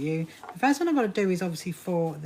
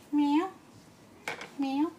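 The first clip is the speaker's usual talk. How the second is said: mock meow; 8 semitones higher than usual. Voicing the cat.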